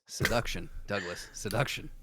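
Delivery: low, soft mock-sultry purr; mock seductive. Teasing his friend in a mock seductive voice. Joking about the goal of thongs.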